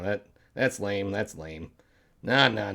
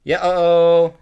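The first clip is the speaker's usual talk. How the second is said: ordinary delivery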